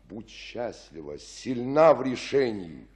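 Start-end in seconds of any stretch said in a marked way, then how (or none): 1.2-2.8 firm loud. Acted. Friar sends Juliet off.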